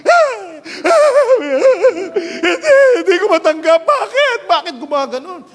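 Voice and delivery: high, loud mock-hysterical sobbing; hysterical wailing. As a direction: wail hysterically, hammed up. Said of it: "nag-hysterical".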